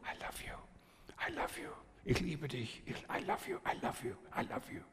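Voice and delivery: hushed, unvoiced whisper of affection; repeated soft whispered endearment; whispering in ear. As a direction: whisper it softly into a small child's ear. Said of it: "flüsterte in ihr Ohr".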